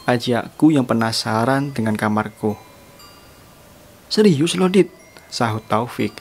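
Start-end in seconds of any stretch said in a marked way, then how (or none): 4.1-4.9 high, disbelieving check with a friend; acted surprise, pitched up; incredulous question. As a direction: ask it high-pitched, as if doubting he means it. Friend reacts to a daring proposal.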